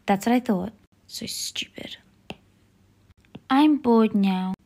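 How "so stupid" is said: barely voiced whisper of a grumble. Kid muttering after Grandma's scolding, whisper. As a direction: breathe it out so nobody hears.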